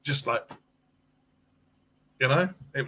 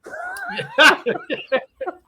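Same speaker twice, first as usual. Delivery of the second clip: shrieking laugh; real high laughing fit; high squealing laugh; 6 dB louder than usual. Real, not acted. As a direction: crack up in a squeal. Big laugh.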